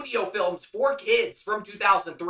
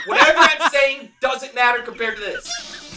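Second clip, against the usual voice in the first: loud, laughing eagerness; 3 semitones higher, 7 dB louder than usual. Real, not acted. Host laughs, wanting the nightmare story, laughing.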